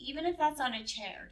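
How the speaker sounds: ordinary delivery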